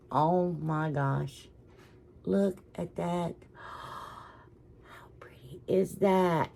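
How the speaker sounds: soft, slow gasp of wonder sinking to whisper; hushed awe. Real, not acted. Showing viewers a pretty find, hushed in awe. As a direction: breathe it out slowly, awestruck. Marveling at a pretty item shown.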